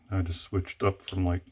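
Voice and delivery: ordinary delivery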